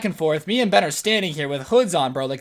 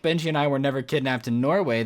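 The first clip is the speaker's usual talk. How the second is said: ordinary talk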